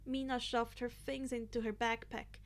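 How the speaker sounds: plain reading voice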